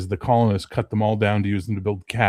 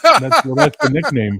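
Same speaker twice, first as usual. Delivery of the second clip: startled; 7 semitones higher, 8 dB louder than usual. Real, not acted. Startled reply, loud.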